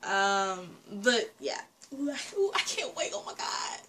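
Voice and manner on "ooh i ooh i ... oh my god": breathy hushed whisper of anticipation; excited whisper. Real, not acted. Reacting to music video, whispering with excitement. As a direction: whisper it, too thrilled to wait.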